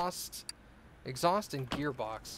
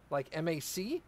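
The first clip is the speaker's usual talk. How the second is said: ordinary delivery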